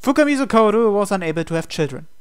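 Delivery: ordinary talk, nothing marked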